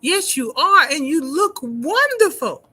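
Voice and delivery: ordinary talk, nothing marked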